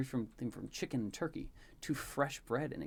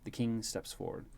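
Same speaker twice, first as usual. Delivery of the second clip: usual speaking voice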